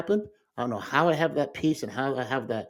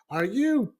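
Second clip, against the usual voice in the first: higher, stunned disbelief; are you serious, pitched up in shock; incredulous brother; slower than usual. Retold or imitated.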